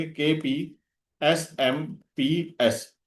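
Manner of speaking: slow letter by letter, each letter drawn out; slow teacher's mnemonic spelling; spelled-out mnemonic. Shown as a demonstration. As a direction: spell the memory code very slowly for students. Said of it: "तो कैसे याद रखेंगे?".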